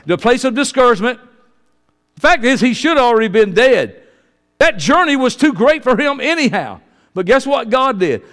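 loud, high, driving pulpit cry; preaching at full force. Acted. Preacher tells church of Elijah's journey, loud and high. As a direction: lift it up and push it out to the back row.

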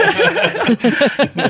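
a burst of high giggling; high laugh. Real, not acted. Host cracking up at her own "we hoes" pun. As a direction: crack up at your own joke.

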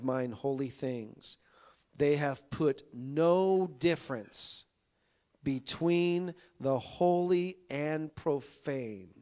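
slow, loud, weighty scripture reading; preaching scripture. Acted. Preacher reading Ezekiel to his congregation, slow and loud. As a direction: proclaim each word slowly like a verdict.

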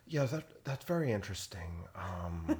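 a light, soft-spoken radio host voice; Terry Gross impression. Mocking a radio interviewer for his brother, soft and understated. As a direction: murmur it gently like an interview host. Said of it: "Terry Gross".

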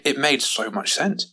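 plain reading voice

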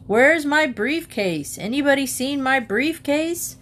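father calling down for his briefcase; calling upstairs. A narrator's reading.

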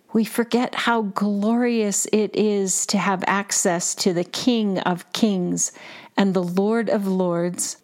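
ordinary delivery

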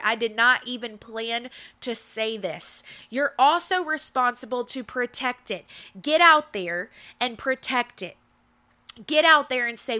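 ordinary talk, nothing marked

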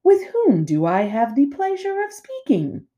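high, sweetly polite robot; friendliest robot voice. A narrator's reading. Robot interrupting the bickering bears, extra friendly. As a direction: ask with exaggerated courtesy. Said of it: "the robot's friendliest voice".

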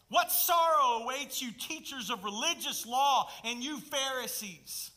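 loud, high preacher's proclamation; preaching at full force. Acted. Preacher proclaiming Jesus' rebuke to the church. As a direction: thunder it from the pulpit.

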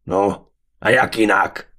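slow, loud brutish voice; brutish warrior retort. A narrator's reading. The warrior is mocked for his plan, retorting loudly. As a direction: answer back like a slow-witted brute.

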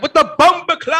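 loud, high mock Jamaican outburst; horrible Jamaican accent. Irate Jamaican in the audience, bad mock Jamaican accent. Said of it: "that is a horrible Jamaican accent".

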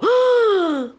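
big huffing breaths; wolf blowing. Acted. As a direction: huff and puff hard.

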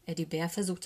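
usual speaking voice

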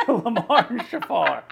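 slow, laughing repetition. Real, not acted. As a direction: laugh through the name. Laughing at the name.

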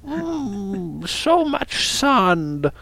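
high, very slow mock Texan; failed Texan accent. Co-host musing on desert sand, mock Texan accent. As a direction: stretch out a badly faked Texan accent, soft and high. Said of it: "That's my Texan accent".

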